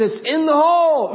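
high, loud victory holler; imitated yell. Friend announcing the hole-in-one from the green, jubilant shout. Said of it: "when his voice sounded and I heard his voice".

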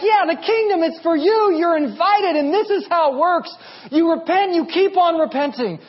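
high-pitched, eager invitation; emphatic welcoming Jesus. Acted. Jesus invites people into the kingdom, high and emphatic. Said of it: "Jesus is like".

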